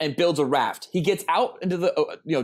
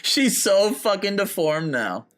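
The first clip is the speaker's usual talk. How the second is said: slow, higher, hammed-up disgust; mock outrage; 5 semitones higher, slower than usual. To his co-host about the scarred star, mock outrage. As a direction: overplay the horror as a joke.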